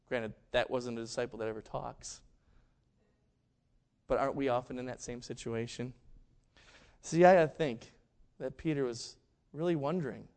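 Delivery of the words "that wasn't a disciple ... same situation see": ordinary talk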